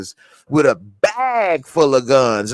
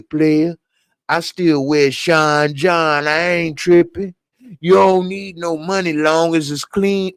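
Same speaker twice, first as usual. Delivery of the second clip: higher, laid-back player voice; imitating Darnell; 5 semitones higher than usual. Imitating Darnell telling Shad to relax in a high smooth voice. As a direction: lean back and sell it like a smooth hustler. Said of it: "That's how he talk".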